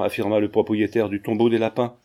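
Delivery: ordinary delivery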